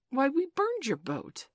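high, loud, slow contradiction; protesting. A narrator's reading. Child disputing the boat sighting, protesting.

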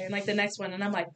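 usual speaking voice

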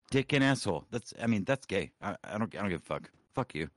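ordinary talk

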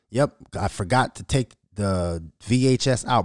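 ordinary talk, nothing marked

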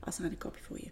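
soft whispered promise; whispering. Real, not acted. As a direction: whisper it close, as a private offer. Guest offers signed book.

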